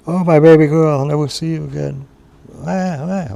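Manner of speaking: high, loud keening that breaks into wah sobs; wailing cry. Acted. Mother crying loudly to find the baby, high wail. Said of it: "she started to cry again".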